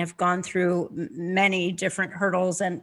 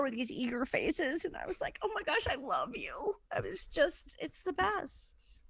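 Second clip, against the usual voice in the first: high, soft gushing; hushed, tender adoration; overcome with affection; 7 semitones higher, 8 dB quieter than usual. Retold or imitated. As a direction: melt into a small high voice. Moved recalling her students' faces.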